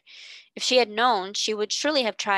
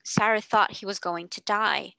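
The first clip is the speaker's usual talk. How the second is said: ordinary delivery, nothing marked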